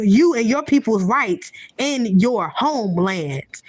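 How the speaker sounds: usual speaking voice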